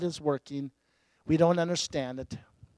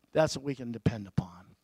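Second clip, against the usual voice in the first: soft echo; 6 semitones lower, 6 dB quieter than usual. Acted. Repeated line, softer echo.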